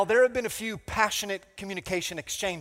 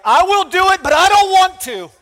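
much higher, louder reluctant protest; loud grudging whine; 13 semitones higher, 14 dB louder than usual. Retold or imitated. Pastor answers the Holy Spirit, loud reluctant comic whine. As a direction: yell your reluctant yes to God.

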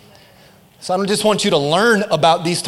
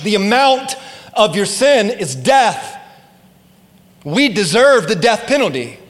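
ordinary talk, nothing marked

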